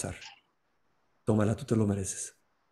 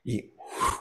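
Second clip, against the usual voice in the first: soft unvoiced puff of breath; a quick breath that makes the rock vanish; soft blowing puff; 7 dB quieter than usual. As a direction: blow the rock away with one breath. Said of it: "con un soplido".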